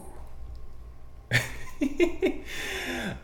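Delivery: long, high wheezy giggle; giggling laugh. Real, not acted. Host tickled by his own Romero accent, high giggling. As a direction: crack up in a high, drawn-out laugh.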